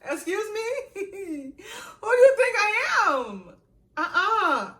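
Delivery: high, loud offended "excuse me"; shrill-high outraged comeback; indignant disbelief. Retold or imitated. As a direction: scoff at the nerve of her. Her indignant reaction to senior.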